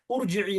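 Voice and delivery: उरजिई opening on "ur"; initial short u. Shown as a demonstration. Teacher rules out a misreading, उरजिई with initial "u". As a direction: say उरजिई with a "u" as first vowel. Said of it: "नहीं लगेगा".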